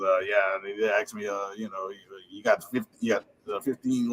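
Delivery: low, halting Cajun coach voice. Retold or imitated. Coach retelling talk of his contract, low imitated voice. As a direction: stumble along low as the coach. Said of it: "he talks like that".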